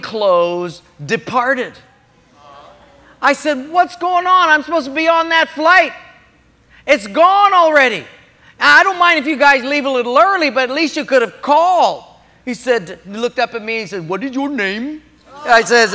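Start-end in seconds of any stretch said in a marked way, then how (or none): 3.7-12.0 loud, high indignant complaint; acting out an upset passenger; exasperated, pitched-up objection; retold protest. Retold protest over missed flight. 14.1-15.0 imitated East Indian accent; lilting East Indian accent. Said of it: "from an East Indian accent".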